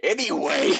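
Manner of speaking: usual speaking voice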